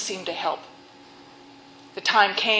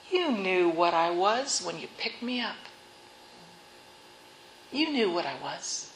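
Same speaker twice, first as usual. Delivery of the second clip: cold; 6 dB quieter than usual. Acted. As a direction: lay it out coldly, no pity. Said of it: "the snake answered coldly".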